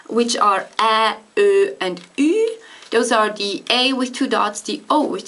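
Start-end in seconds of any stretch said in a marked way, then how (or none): 0.8-2.6 ä, ö, and ü said slowly and clearly; ä, ö, and ü, each vowel drawn out; umlauts. Shown as a demonstration. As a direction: umlauts demonstrated for learners. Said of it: "the umlaut".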